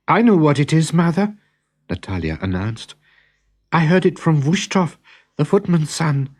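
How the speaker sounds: put-on high, bright announcing girl's voice; eager daughter. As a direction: proudly share the gossip. Daughter announces what she heard.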